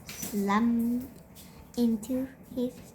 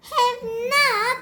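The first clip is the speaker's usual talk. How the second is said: put-on high, loud indignant shriek of a small boy; 12 semitones higher, 5 dB louder than usual. A narrator's reading. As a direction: shriek it back at him in outrage. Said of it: "shrieked Peter".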